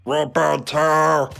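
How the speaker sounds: slow, drawn-out strongman call; Strong Mad voice. Retold or imitated. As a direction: stretch it out like a dim strongman. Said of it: "a better Strong Mad impression".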